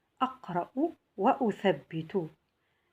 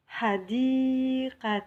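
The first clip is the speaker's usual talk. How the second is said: "حديقة" drawn out, long second syllable held higher; slow model reading; 5 semitones higher than usual. Shown as a demonstration. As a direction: stretch the middle of "حديقة" for learners. Teacher models reading an Arabic word.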